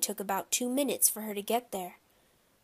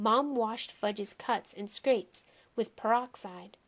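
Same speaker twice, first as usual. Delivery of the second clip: plain reading voice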